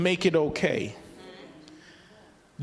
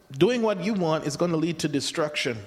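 usual speaking voice

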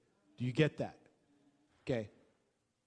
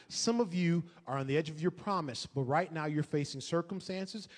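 ordinary talk

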